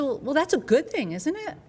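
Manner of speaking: high, bright mock cheer; mock cheerful. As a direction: play up a falsely happy doctor. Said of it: "Like, I'm all happy".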